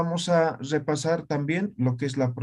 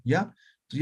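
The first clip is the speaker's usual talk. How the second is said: ordinary talk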